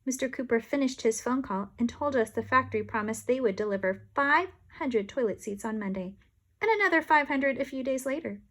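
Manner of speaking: usual speaking voice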